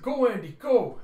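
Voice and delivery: very loud, high shout; desperate, full-voiced command; urgent yell. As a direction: scream at your husband to run. Attacked wife urges husband to flee.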